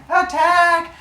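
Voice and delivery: high shouted charge; battle cry. Acted. The freed siblings rush Kronos, battle cry. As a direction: yell it as a charge.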